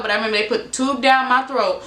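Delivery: ordinary talk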